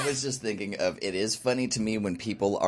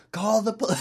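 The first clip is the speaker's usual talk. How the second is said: mock yelling; 11 semitones higher than usual. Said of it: "yelling to no one".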